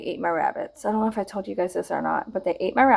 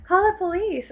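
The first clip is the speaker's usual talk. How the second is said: mocking prissy voice; 10 semitones higher, 3 dB louder than usual. Call lady advice mocked.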